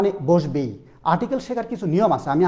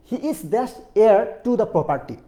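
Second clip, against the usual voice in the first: slow example; 2 semitones higher, much slower than usual. Shown as a demonstration. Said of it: "এইসের উচ্চারণটা কিন্তু নাই".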